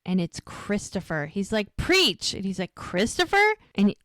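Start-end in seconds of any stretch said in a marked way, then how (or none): 1.8-2.3 high, louder eager call; light up at hearing him; excited greeting. Retold or imitated. Boy phoning Preacher in secret, high eager call. 2.8-3.6 high, rising startled question; surprised question. Retold or imitated. Preacher surprised by boy's call, high startled question.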